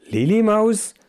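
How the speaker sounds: high, astonished question; baffled. A narrator's reading. Mice hear who is accused, baffled. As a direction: query the name in sheer bafflement. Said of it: "fragten die Mäuse verblüfft".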